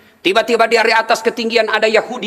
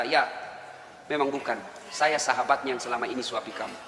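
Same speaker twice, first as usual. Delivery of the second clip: much softer and lower, choked; grieving friend's hushed reply; tearful answer; 10 semitones lower, 13 dB quieter than usual. Acted. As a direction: confess it through tears. Abu Bakar weeping, answering.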